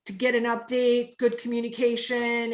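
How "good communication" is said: ordinary delivery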